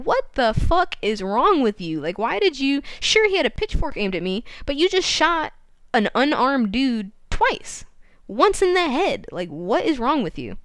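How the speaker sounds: acted incredulous rant, pitch jumping up; outraged. Susan confronts Ed over the shooting, acted outrage. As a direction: lay into someone in mock outrage.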